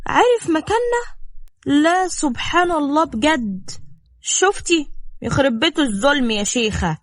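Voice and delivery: high-pitched mock amazement; sarcastic disbelief. To a woman doubting her brother's coincidence, sarcastic disbelief. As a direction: feign astonishment, pitch jumping up.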